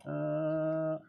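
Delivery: choppy audio cutting in and out; broken stream audio dropping out; tape dropouts. Real, not acted. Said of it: "Audio keeps cutting out".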